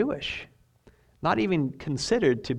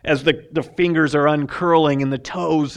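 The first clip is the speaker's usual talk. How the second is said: usual speaking voice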